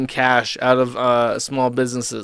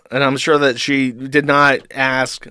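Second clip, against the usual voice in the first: ordinary delivery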